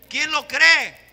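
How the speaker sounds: loud call to the congregation with ¿Quién lo cree? falling at the end; preaching call. Real, not acted. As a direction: ask ¿Quién lo cree? loudly at a normal pace.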